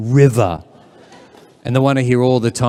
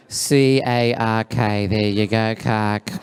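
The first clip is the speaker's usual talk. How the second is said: low, offhand, slow spelling; very casual; 4 semitones lower, much slower than usual. Acted. Barista writing the wrong name, very casual. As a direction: toss it off without a second thought. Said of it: "just very casually".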